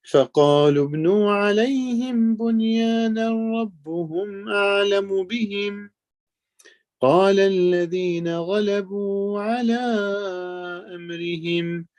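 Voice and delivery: chanted verse, carried on the melody; Quran recitation. Acted. Teacher reciting the verse of the people of the cave, melodic chant. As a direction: intone each phrase of the verse.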